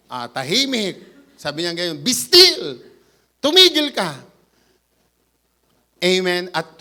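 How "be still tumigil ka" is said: firm command. Retold or imitated. Jesus commanding the wind.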